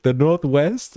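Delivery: lightly voiced clueless bouncer asking; imitated bouncer. Club bouncer guessing at the state, mock puzzlement. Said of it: "bouncers at clubs and stuff would be like".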